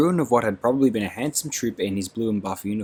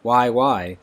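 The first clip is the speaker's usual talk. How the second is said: louder, exasperated cry; 3 semitones higher, 7 dB louder than usual. Acted. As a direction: throw the question back hotly. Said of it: "cried Beatty".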